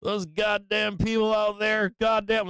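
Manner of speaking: high, loud drawn-out yell; mock screaming. Imitating people who scream at the internet, mock screaming. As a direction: holler it like an outraged commenter. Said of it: "scream at the internet".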